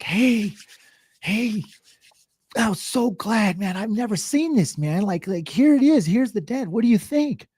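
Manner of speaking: high, eager customer voice; mock customer. Customer showing the dent to the repairman, high eager voice. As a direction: ham up a chatty customer. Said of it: "the customer's like".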